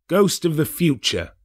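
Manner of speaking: mild frightened exclamation; exclaiming. Acted. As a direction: call out to the phantom in alarm. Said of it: "he exclaimed".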